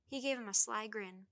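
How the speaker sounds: usual speaking voice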